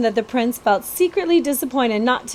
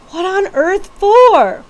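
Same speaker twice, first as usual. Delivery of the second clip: disgusted surprise; 10 semitones higher, 7 dB louder, slower than usual. Acted. Betsy disgusted at rats.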